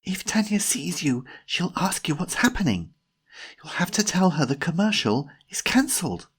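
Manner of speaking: high, agitated stage whisper; whisper-scold in a high, urgent voice. A narrator's reading. Jemima reminds Timothy about the commercial, agitated high whisper. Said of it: "whispered Jemima".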